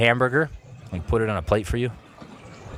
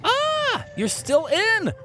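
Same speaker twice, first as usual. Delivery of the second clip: very high startled yelp; a sudden yelp of surprise; surprised shout; much slower than usual. Real, not acted. Streamer startled while reading chat, high yelp.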